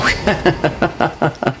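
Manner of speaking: a brief amused chuckle; short real laugh. Real, not acted. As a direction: laugh it off lightly. Laughs at friend's remark.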